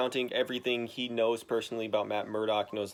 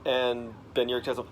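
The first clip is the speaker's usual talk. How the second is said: ordinary talk, nothing marked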